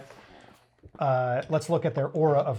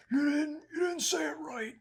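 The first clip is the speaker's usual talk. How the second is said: very high cartoon princess voice; Princess Rainicorn voice; 13 semitones higher, 5 dB quieter than usual. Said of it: "Princess Rainicorn voice".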